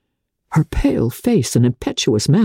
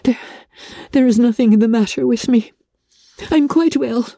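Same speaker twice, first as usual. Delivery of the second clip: higher voice, trying to sound composed; distressed young woman; 8 semitones higher than usual. A narrator's reading. Elizabeth declines Darcy's help, higher and strained but polite. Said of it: "She burst into tears as she alluded to it".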